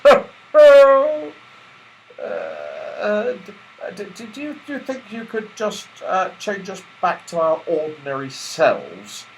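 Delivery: high, stammering worried plea; nervous stammer. A narrator's reading. Joe anxiously asking Santa to change them back, stammering. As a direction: stutter out the worry. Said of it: "asked Joe, afraid".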